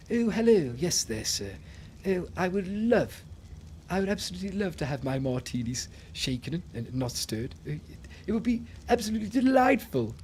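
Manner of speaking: hammed-up upper-class English; posh English accent. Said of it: "people from England sound like".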